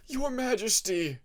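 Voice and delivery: high, loud, sobbing plea; sobbing cry. Acted. Nightwing begs the Rainwing king, loud sobbing plea. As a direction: cry out to your king through tears. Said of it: "the Nightwing sobbed".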